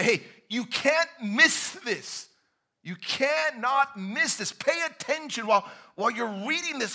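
urgent shout of Focus; urgent shaking plea. Pretend. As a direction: shake them by the shoulders with your voice. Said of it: "grab you by the shoulders and shake you up and say".

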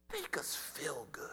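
soft whispered plea; pleading whisper. Acted. Preacher voicing believers begging to feel good, whispered. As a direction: breathe it like a secret wish.

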